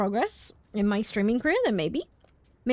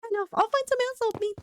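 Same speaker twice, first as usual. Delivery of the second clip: put-on higher, frantic scared young man; panicked client; 8 semitones higher than usual. Client giving up on the detective, frantic. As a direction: storm off in desperate panic.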